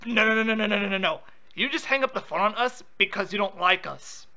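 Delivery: ordinary talk, nothing marked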